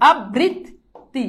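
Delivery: "আবৃত্তি" very slow as ab, brit, ti; ভাঙা উচ্চারণ. Shown as a demonstration. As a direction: pause between syllables of "আবৃত্তি". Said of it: "তারপর কি হবে". ("আবৃত্তি" ধীরে, আব্-বৃত্-তি ভেঙে)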